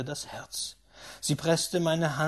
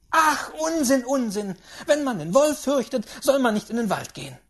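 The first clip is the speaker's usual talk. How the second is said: loud, high scoffing; impatient old lady; scornful great-aunt; 9 semitones higher, 9 dB louder than usual. Acted. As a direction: dismiss it with a loud outburst.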